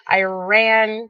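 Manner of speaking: ordinary talk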